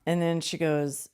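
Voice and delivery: ordinary delivery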